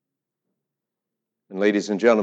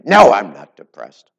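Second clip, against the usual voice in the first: angry denial; 4 semitones lower, 10 dB louder, slower than usual. Retold or imitated. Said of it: "And she got angry with me".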